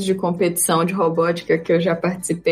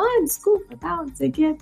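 apologetic people; 7 semitones higher than usual. Retold or imitated. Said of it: "eles falam".

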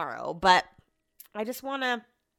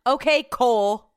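mimicking Cole; 3 semitones higher, 7 dB louder, slower than usual. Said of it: "you're Cole from Love Is Blind".

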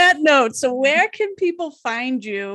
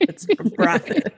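laughing; much slower than usual. Real, not acted. Correcting the name, laughing.

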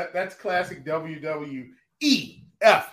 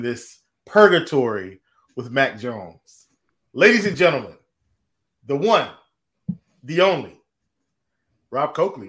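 grand announcer intro; 2 semitones lower, 3 dB louder than usual. Acted. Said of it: "Thanks for that backhanded introduction".

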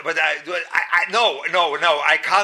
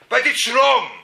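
louder, higher protest; insisting; 10 semitones higher, 8 dB louder, slower than usual. Real, not acted. Speaker protesting his wife's way is wrong. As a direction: push back hard that it's wrong.

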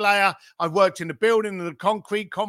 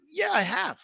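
a hint of a homeowner, higher, answering sheepishly; voiced homeowner; 8 semitones higher, 3 dB quieter than usual. Homeowner answers the salesman with a lightly higher voice. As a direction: play the homeowner admitting the bruise.

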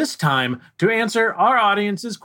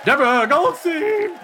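announcer voice; 12 semitones higher than usual. Acted. Announcer introduces host.

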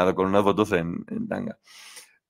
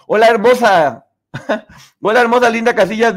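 much higher, louder mock sweet talk; mock flirty greeting; 10 semitones higher, 8 dB louder than usual. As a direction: ham up a smarmy admirer crooning hello. Imitating a flirty fake profile.

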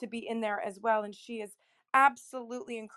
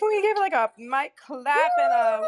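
excited; 10 semitones higher, 10 dB louder than usual. Real, not acted. Hyping up the room.

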